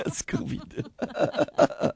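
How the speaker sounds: helpless laughter at own fumble; cracking up. Real, not acted. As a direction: lose the ad read to giggles. Host cracks up botching ad.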